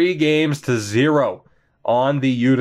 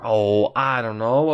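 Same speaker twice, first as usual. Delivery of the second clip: ordinary talk, nothing marked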